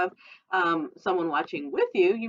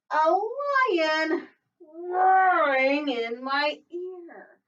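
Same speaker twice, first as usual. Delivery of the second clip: roaring lion; 10 semitones higher, much slower than usual. Acted. Animal sound acted in story.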